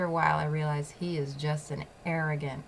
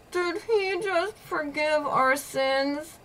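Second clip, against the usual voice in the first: high, slow, cracking disbelief; stunned disbelief; 10 semitones higher, much slower than usual. Retold or imitated. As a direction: gasp it out as if you can't believe your ears. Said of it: "I'm like".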